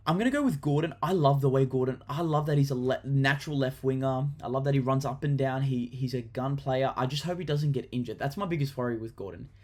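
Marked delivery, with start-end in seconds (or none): none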